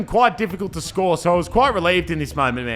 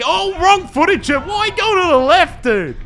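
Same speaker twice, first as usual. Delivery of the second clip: much higher, louder mock cry; mock exasperation; 12 semitones higher, 5 dB louder, slower than usual. Owner mocking his keeper's dive, high exasperated cry. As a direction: squeal it in disbelief at the keeper. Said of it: "I'm like".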